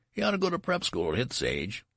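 high-pitched heckle; jeering taunt. A narrator's reading. Undergraduates mocking Benjamin's age, jeering.

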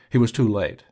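plain reading voice

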